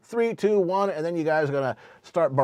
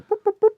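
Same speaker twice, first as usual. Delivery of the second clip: squeaky high boop noises; 9 dB louder than usual. Pretend. Sound effect for the vote.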